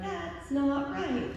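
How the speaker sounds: high, drawn-out remark; mock alarmed reaction. Onlooker spots a bear climbing out, mock alarm.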